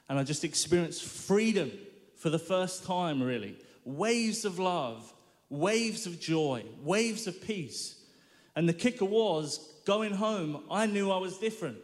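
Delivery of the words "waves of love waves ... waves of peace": very slow, letting each wave land; slow and savoured. Real, not acted. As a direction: draw out each phrase slowly and reverently. Slow testimony of freedom.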